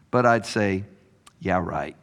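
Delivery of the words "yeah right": low, soft doubting mutter; sceptical mutter. Retold or imitated. Boy in the pew muttering his doubt, softly. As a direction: roll your eyes under your breath. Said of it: "mutter to myself".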